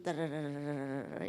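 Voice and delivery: low hummed drum roll; mock drum roll. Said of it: "drum roll". (redoble de tambor imitado con la voz)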